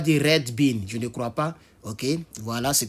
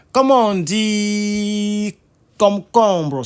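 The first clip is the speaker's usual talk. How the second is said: slow, loud question with a long held last vowel; drawn-out prompt; 6 semitones higher, 7 dB louder, much slower than usual. Real, not acted. Teacher asking the class for a translation, long drawn-out cue. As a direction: stretch the last word out loudly. Teacher cues the next word.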